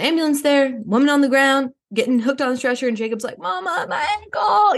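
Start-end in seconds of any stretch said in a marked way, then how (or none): 3.4-4.8 slow, high wailing cry for mama; kid in pain. Retold or imitated. Jacob hurt on the snowboard calling mom, high mock wail. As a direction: whimper like a hurt little boy.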